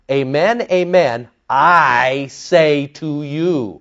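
slow, solemn divine declaration; divine authority. Acted. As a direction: stretch out the last words with weight. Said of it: "Only God can do that".

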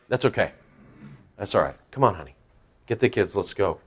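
very soft, low, deflated acceptance; quiet resignation. Acted. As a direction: give up gently and gather the family. Acting the rebuffed father leaving.

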